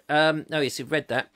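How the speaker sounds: ordinary talk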